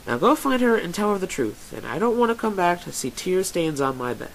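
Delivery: high, insistent mare; bossy Bon Bon. Acted. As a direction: send them off with a firm push. Bon Bon orders the listener off.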